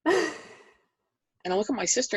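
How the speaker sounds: plain reading voice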